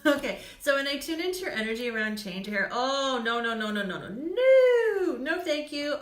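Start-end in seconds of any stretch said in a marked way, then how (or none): 2.7-6.0 mock refusal. Playfully refuses the card Change.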